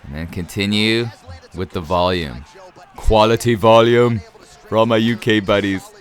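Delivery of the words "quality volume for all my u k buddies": playful put-on UK accent. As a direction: slip into a mock British accent for a moment. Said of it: "speaking in a U K accent".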